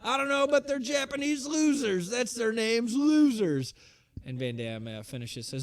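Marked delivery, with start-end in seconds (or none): 0.0-3.8 very high, pushed manager jeer; Bill Alfonso. Retold or imitated. Manager dismissing the other team, hammed-up impression. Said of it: "And Bill Alfonso says".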